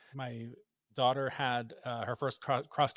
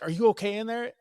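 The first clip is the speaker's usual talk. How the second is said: high, raised call through a door; louder, higher questioning call, acted; imitated worried call; 9 semitones higher, 6 dB louder than usual. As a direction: imitate a concerned mother checking on a child. Wife calls through locked door.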